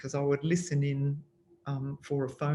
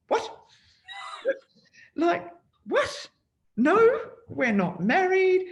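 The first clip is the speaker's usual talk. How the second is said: high, loud retold disbelief; shocked; 6 dB louder than usual. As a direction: re-enact a stunned, high-pitched protest. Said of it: "I remember being shocked".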